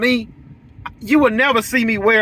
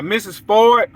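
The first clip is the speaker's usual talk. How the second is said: normal talking voice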